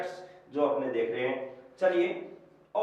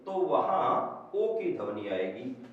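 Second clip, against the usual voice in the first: ordinary talk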